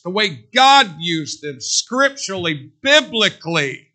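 loud, driving sermon delivery; forceful preaching. Acted. Preacher pressing the congregation, loud and driving. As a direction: preach it hard from the pulpit.

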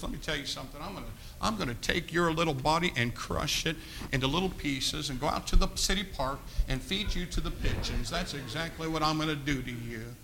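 low quiet threat; a hint of a menacing giant.

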